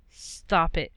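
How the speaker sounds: lightly voiced stern mother; understated no-nonsense order; firm. A narrator's reading. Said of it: "she said firmly".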